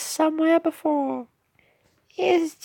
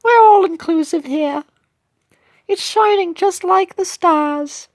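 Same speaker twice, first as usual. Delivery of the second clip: cookie character in British voice; 3 dB louder than usual. Acted. Cookie admiring the festive lights, British character voice.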